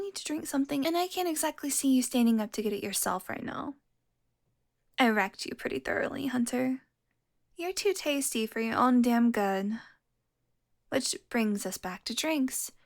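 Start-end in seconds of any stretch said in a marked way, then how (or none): none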